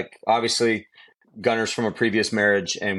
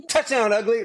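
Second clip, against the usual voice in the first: a light, high imitation of a little boy; playful toddler bravado, said lightly; 12 semitones higher than usual.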